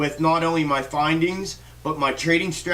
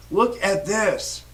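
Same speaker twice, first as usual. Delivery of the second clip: slow, slightly raised, insistent "Look at this"; insistent emphasis; 3 semitones higher, much slower than usual. Real, not acted. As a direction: LOOK AT THIS drawn out slowly, word by word. Urging viewers to see the chart.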